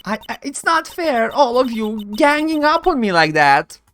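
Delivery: high-pitched wounded whine; hammed-up high, stammering self-pity; tearful witness protest. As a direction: protest as if about to burst into tears. Witness acted whining under attack.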